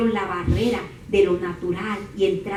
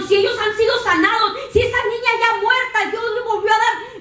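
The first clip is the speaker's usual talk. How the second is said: high, loud eager voice; excited faith; 14 semitones higher, 5 dB louder than usual. Acted. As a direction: burst out believing. Blind men declare their faith. (los ciegos exclamando su fe con emoción)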